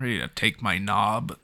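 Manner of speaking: low, soft mock British; put-on British bloke; British accent. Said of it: "in a British accent".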